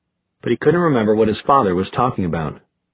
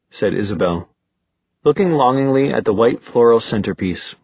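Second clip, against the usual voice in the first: ordinary delivery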